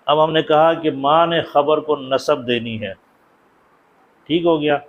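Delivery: ordinary talk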